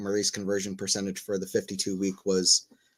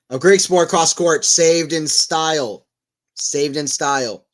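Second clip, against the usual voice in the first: loud, higher excited call, slowing on saved in style; 4 semitones higher, 7 dB louder, slower than usual. Real, not acted. Commentating a saved point for viewers, excited loud call. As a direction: shout the save like a commentator. Calling a big point save.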